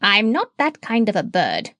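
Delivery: lightly voiced proud parrot; a bird defending her honour. A narrator's reading. Parrot climbing out of the doctor's pocket, indignant.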